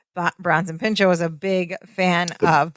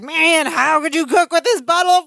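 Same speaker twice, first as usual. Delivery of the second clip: screechy scolding; 8 semitones higher than usual. Retold or imitated. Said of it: "He's screechy".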